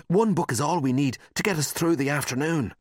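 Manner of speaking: plain reading voice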